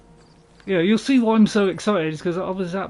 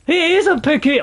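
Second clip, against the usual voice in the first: high, loud thrilled cry; excited; 11 semitones higher, 7 dB louder than usual. Real, not acted. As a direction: burst out with real delight at the find. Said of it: "I'm all excited".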